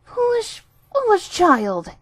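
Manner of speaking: put-on the old sow cries out in dismay; distressed cry. A narrator's reading. Mother pig dismayed at her greedy daughter, slow distressed cry. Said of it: "looking quite distressed".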